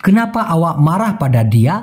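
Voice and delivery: very slow, each Malay word spaced out; slow clear Malay. Shown as a demonstration. Teacher to Bengali learners, very slow careful Malay. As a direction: spell the phrase out by ear for learners. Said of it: "ওই কথাটা এভাবেই বলতে হবে".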